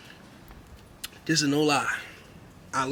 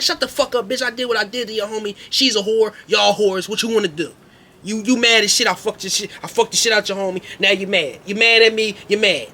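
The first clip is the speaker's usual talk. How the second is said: louder, higher mocking rant; angry taunting yell; 4 semitones higher, 4 dB louder than usual. Narrator taunting the angry girls, loud mocking rant. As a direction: taunt them hard and loud.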